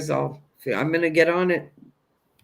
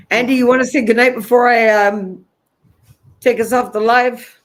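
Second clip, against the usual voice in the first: raised, quicker call to Andy; calling across the room; 6 semitones higher, 8 dB louder than usual. Real, not acted. Host calls Andy over to sign off, raised voice.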